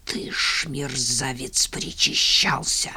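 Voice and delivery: menacing hiss; sneering panto villain. A narrator's reading. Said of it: "зловеще прошипел Иван Мороз".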